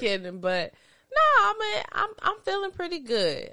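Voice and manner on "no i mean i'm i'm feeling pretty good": high emphatic. Real, not acted. Emphatic reassurance.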